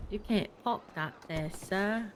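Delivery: softly practised London accent; attempted London accent. Shown as a demonstration. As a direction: try out a London accent under your voice. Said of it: "I'm trying to do your accent".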